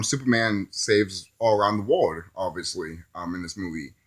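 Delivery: normal talking voice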